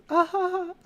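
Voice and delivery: high, loud ha-ha laugh; signature laugh demo. Shown as a demonstration. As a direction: show off your piercing laugh. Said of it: "You know how my laugh is".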